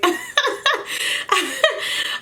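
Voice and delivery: long high breathy laughter; drawn-out high giggle. Real, not acted. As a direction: crack up at the picture of him. Laughs at boyfriend's hair.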